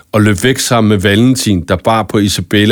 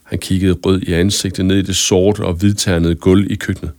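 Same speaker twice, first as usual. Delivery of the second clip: usual speaking voice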